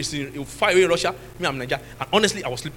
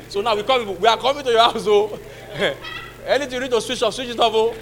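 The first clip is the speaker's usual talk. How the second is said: loud, raised voice breaking into laughter; mock visitors' announcement; 3 semitones higher, 6 dB louder than usual. Church brethren warning a friend they are coming, loud laughing announcement. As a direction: call out jokily to a whole house.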